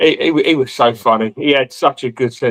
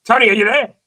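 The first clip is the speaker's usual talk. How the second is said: high-pitched pestering call to a sleeping mate; mimicked wake-up call; 10 semitones higher than usual. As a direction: imitate someone insistently calling, climbing higher.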